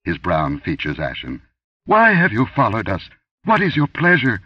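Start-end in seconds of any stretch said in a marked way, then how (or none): 1.8-4.4 high, loud fearful pleading; frightened high priest. A narrator's reading. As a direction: beg to know what she wants. Ashen priest addresses the goddess.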